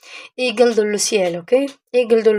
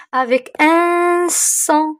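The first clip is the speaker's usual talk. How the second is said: "avec un son" slow and a bit higher; stress on un son; 5 semitones higher, much slower than usual. Shown as a demonstration. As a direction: lean on "un son" slowly. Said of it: "stresses the key phrase". (insister lentement sur "un son")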